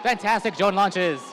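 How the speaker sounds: rising excited play-by-play; dueling hype announcers. Real, not acted. Drone launches in end game.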